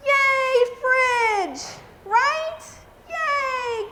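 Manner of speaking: high, loud little cheer. Real, not acted. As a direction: celebrate the fridge like a win. Cheering for the fridge.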